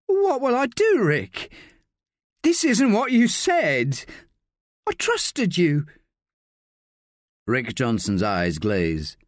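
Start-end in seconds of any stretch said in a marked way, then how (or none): 0.1-5.9 high, slow hurt voice; betrayed young woman. A narrator's reading. Sally telling Rick she trusted him, hurt and high. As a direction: reproach him, lost and hurt.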